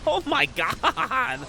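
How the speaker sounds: slow, high, laughing oh my god; laughing disbelief. Real, not acted. Caster laughing at the endless conga line, slow and high.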